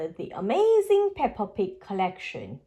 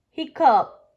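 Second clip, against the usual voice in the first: hiccup; 4 semitones higher, 6 dB louder than usual. Acted. Hiccup sound in story.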